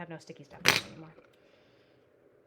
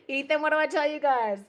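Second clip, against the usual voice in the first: laughing; 7 semitones higher, 11 dB louder than usual. Real, not acted. Laughing then addressing viewer.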